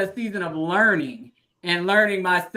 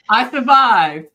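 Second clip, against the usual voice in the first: relieved laugh; 8 dB louder, slower than usual. Real, not acted. Relieved after emotional speech.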